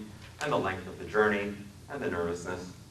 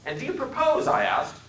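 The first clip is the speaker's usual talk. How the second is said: mocking question; 11 semitones higher, 6 dB louder than usual. Narrator mocks the passenger.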